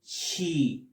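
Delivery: "she" as a long, drawn-out "shee"; pronunciation model. Shown as a demonstration. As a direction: model "she" slowly for learners. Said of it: "y se pronuncia".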